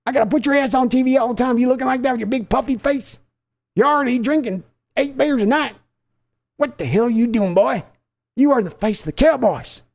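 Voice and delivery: scolding father. Acted. Father scolding his son for eating ice cream, high angry tirade.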